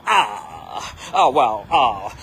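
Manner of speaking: breathy mock awe, sighing out each wow. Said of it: "They need you to sound like this".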